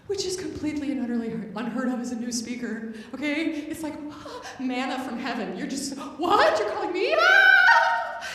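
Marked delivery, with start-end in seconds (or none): 4.0-4.5 sharp excited gasp; a breathless delighted gasp; airy intake of surprise. Retold or imitated. Excited call, manna from heaven. 6.2-8.3 high, loud, sung-out disbelief; giddy mock astonishment; amazed. Retold amazement at phone call.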